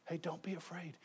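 soft, hushed reassurance; gentle angel. Retold or imitated. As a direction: say it softly, like an angel calming a frightened shepherd. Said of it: "what's the first thing he says".

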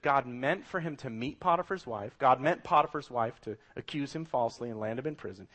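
usual speaking voice

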